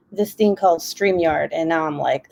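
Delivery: normal talking voice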